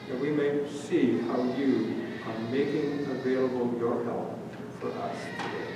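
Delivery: hushed prayer that fades to a whisper; earnest pastor's prayer, sinking into a whisper. Acted. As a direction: pray it softly, trailing away to breath. Pastor leads closing prayer.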